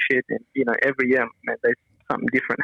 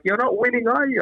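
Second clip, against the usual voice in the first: lightly voiced heckle, higher pitch; mock a needling audience member, pitched up; retold female heckler; 8 semitones higher than usual. Girl in the crowd taunts the comedian, imitated heckle.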